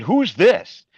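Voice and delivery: high, loud, startled question; retold surprise. Bhakta asking about the ceiling picture, startled. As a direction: ask it as if seeing the picture for the first time. Retelling first sight of the picture.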